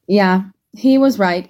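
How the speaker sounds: ordinary delivery, nothing marked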